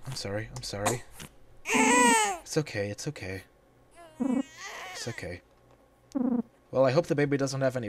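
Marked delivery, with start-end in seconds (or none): 1.6-2.9 high, slow, blubbering comfort; fake-sob it to the baby; mock crying. 4.2-5.4 high, slow, blubbering comfort; fake-sob it to the baby; mock crying.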